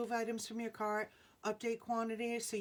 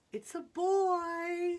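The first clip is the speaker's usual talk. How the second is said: excited announcement; 8 semitones higher, 7 dB louder, much slower than usual. Real, not acted. Announcing gender reveal result.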